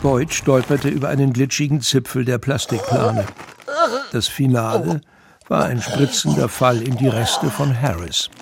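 ordinary talk, nothing marked

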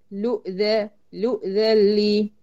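لؤ cut off on the hamza, then a stretched ذن لي; isolated joining. Shown as a demonstration. Teacher repeats the junction from lam to hamza, slow drill. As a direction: drill the syllable لؤ slowly, u stopped by hamza. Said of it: "لؤ من اللام المضمومة على همزة القطع".

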